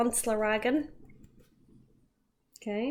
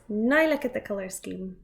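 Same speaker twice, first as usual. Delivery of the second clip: ordinary delivery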